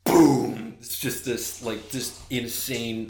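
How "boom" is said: loud, heavy boom; booming impact. Retold or imitated. Game master voices the chest slamming down, booming. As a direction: boom it out like a massive thud.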